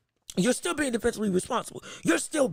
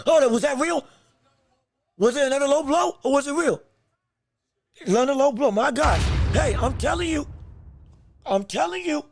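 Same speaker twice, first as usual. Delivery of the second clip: excited shout; 4 semitones higher, 3 dB louder than usual. Real, not acted. Outrage at a low blow.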